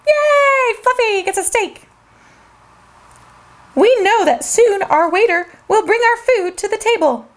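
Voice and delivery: ordinary delivery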